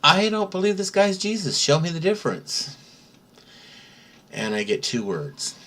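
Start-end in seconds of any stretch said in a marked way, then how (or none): 0.0-2.8 high-pitched plea to the Lord; urgent prayer. Retold or imitated. Confused believer asking the Lord for a sign, urgent prayer. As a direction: pray it high and insistent. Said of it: "I say a little prayer".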